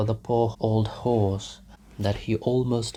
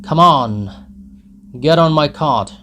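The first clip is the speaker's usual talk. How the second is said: loud, higher peasant shout; drunken shout; 5 semitones higher, 10 dB louder than usual. Acted. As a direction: bellow it at the crowd from the cart. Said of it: "shouted one of them".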